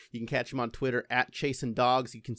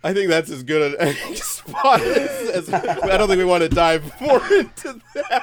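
talking through laughter; cracking up at the end; laughing; 6 semitones higher, 13 dB louder than usual. Real, not acted. Cracking up ending scene.

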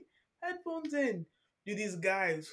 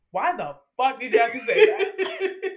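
laughing outrage; 4 semitones higher, 9 dB louder than usual. Real, not acted.

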